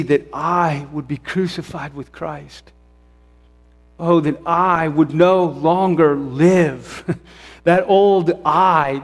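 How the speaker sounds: slow, yearning prayer voice; earnest sighing plea; prayerful longing. Real, not acted. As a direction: draw each word out like a prayer.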